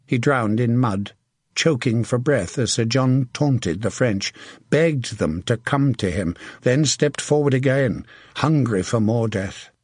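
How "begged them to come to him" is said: plain reading voice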